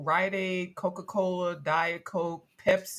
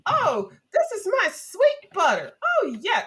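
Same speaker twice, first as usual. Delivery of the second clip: high, louder, syrupy delighted lady voice; imitating Mother Nature; 12 semitones higher, 7 dB louder than usual. Retelling the ad's tasting scene, high syrupy Mother Nature voice. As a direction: gush over the butter like a pleased fairy-tale lady. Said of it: "The man said something about".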